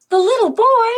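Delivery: high, loud delighted gasp; surprised storyteller gasp; gasping surprise. A narrator's reading. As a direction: react with a gasp of joy. Gasps at the crowd at door.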